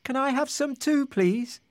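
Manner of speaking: small, high crab voice; little voice. A narrator's reading. Hungry crab asking the fisherman and his wife for food, little voice. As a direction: squeak it politely, pitched well up. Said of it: "they heard a little voice".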